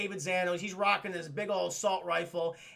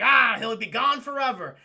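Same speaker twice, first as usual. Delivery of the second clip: louder, higher dismissive outburst; mock exclamation; 5 semitones higher, 9 dB louder than usual. Goliath on the villain going to jail, exclaiming. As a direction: call it out with put-on confidence.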